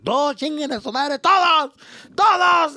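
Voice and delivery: high, loud acted cursing; furious send-off. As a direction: bellow it at everyone, voice way up. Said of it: "they can fuck off".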